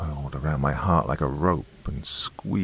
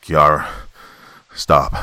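louder commanding shout; loud yell; 2 semitones lower, 7 dB louder, slower than usual. A narrator's reading. Father yelling at his daughter from the stairs. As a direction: stop her in her tracks. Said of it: "at the loud yell".

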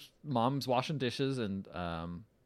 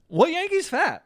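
high, loud, affronted retort; mock indignation; 9 dB louder than usual. Imitating a dad defending his baseball team, mock indignant. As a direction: squawk it in hammed-up outrage.

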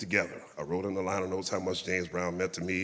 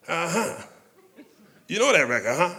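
higher, chuckling delight; 8 semitones higher, 5 dB louder than usual. Retold or imitated. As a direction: let the legend laugh as he asks. Quoting [name], amused.

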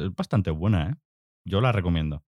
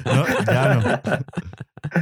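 bursting into high laughter mid-reply; real laughter breaking up the answer; laughing; 5 semitones higher, much slower than usual. Real, not acted. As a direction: laugh through the words. Laughing at friend's joke.

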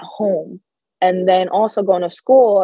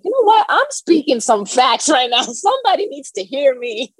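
higher-pitched, self-hyping outburst; fired-up declaration; 6 semitones higher than usual. Retold or imitated. Telling herself someone needs to hear her, fired up. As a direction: re-enact a sudden burst of conviction, half amused. Re-enacting a fired-up moment while driving.

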